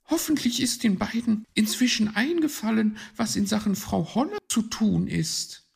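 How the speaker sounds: high, loud, hurried bird lady; chattering high-pitched magpie; magpie thinking aloud. Acted. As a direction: voice her thoughts in a high magpie voice.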